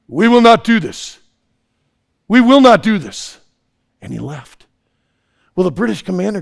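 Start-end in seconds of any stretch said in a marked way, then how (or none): 0.1-3.4 loud, higher-pitched, emphatic refusal; defiant chief. Acted. Gurkha chief refusing the British commander, loud and defiant.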